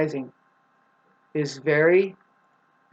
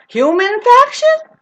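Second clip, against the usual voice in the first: high, loud, stretched-out question; drawn-out "Human faction", shooting up in pitch; incredulous echo; 9 dB louder, slower than usual. Acted. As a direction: squeal "Human faction" slowly in mock disbelief. Repeats phrase as startled question.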